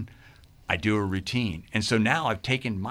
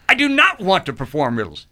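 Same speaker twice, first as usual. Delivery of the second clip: NOT hit hard, higher and louder; a loud, indignant no; loud refusal; 8 semitones higher, 8 dB louder than usual. Retold or imitated. He loudly refuses Cornelia's idea of performing riddles.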